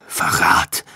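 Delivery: breathy groaned „Verrat"; pained groan. A narrator's reading. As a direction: gasp the word in pain. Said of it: "stöhnte er mühsam". (gequältes Stöhnen)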